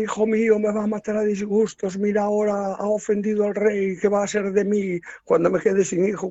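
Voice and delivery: high wailing lament, hammed-up; mock weeping mother. Mother wailing to her son before the king, mock tearful. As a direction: wail like a theatrical mother. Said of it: "la madre empieza a llorar y dice".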